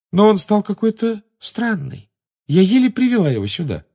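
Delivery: put-on high, plaintive girl's voice; sad. A narrator's reading. Luna tells how she struggled with Ron, sad. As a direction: complain helplessly in a high pitch. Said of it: "грустно сказала Полумна".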